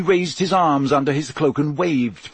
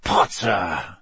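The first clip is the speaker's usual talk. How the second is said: snarled; 6 semitones lower than usual. A narrator's reading. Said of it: "Snape snarled".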